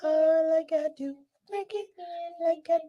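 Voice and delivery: squeaky high chipmunk voice; helium voice. Pretend. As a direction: chirp it way up high. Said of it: "what is that voice?".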